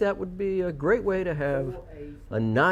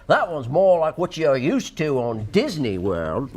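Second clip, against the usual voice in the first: Cockney accent; 3 dB louder than usual. Shown as a demonstration. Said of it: "you could do the Cockney accent".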